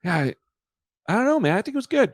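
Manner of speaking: unsure defense. Retold or imitated. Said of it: "And I went".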